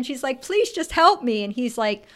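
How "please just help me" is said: imitating a high, urgent plea; mock pleading. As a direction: beg for rescue, playfully acted. Acted-out plea from stuck woman.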